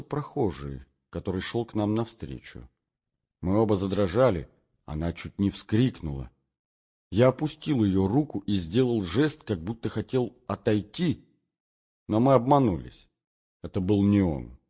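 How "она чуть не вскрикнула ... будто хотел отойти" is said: usual speaking voice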